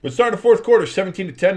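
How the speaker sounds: high, loud quarter kickoff call; loud announcer call. Acted. Streamer opening the fourth quarter, loud announcer call. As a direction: call the restart like it's big news.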